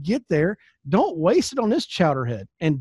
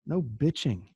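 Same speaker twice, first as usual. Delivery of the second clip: dropped to a soft aside, much softer; blunt version; 4 semitones lower, 8 dB quieter, slower than usual. Shown as a demonstration. Coach muttering the crude word to the audience, softly. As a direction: slip in the crude word quietly. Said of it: "That's the clean way of saying it".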